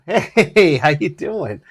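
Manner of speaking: high, loud greeting with a laugh; cheerful loud hello. Retold or imitated. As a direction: greet him loudly over your shoulder. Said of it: "I turn around and go".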